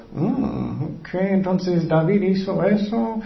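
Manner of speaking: slow, musing put-on voice; Jesus writing sins. Jesus writing David's sin on the ground, slow musing. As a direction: draw it out as if noting it down. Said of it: "Porque él estaba escribiendo".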